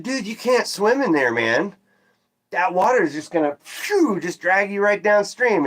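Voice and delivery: high, urgent call to a friend; warning a friend away from a raging river, high and alarmed. Retold or imitated. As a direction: pitch it up in alarm, telling him not to jump.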